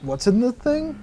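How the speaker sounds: high, curious goblin voice; space hobgoblin voice. Acted. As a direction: ask like a nosy little goblin. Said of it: "says the space hobgoblin".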